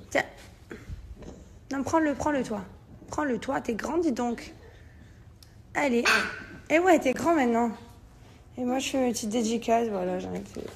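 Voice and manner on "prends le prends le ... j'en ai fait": ordinary delivery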